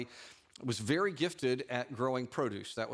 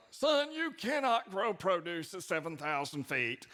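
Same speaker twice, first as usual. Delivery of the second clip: slow, higher-pitched mock old-timer voice; old guys scoffing; 6 semitones higher than usual. Old guys doubting the young farmer, slow put-on voice. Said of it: "all the old guys, experienced people".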